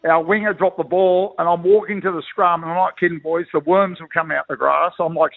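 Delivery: usual speaking voice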